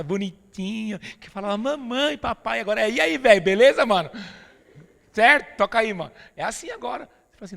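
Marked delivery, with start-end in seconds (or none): 1.6-2.6 a loud hint of a small child; little child. Imita o menininho falando. 2.9-6.1 put-on loud teen bro greeting; teen slang. Imita o filho adolescente.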